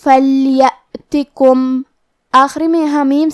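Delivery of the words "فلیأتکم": very slow فلیأتکم, lam sakin clear, ya with zabar, ta with only a zer; clear harakat. Shown as a demonstration. Teacher showing students each harakat, very slow. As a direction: recite فلیأتکم slowly, giving each vowel its exact sound. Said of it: "تا کو صرف زیر سے پڑھنا ہے".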